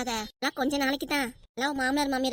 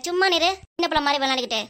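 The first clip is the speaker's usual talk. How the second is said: usual speaking voice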